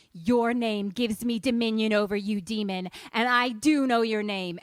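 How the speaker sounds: loud, commanding declaration; loud, righteous command, staring down the demon; commanding exorcist. Retold or imitated. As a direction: proclaim power over the demon. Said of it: "and she says".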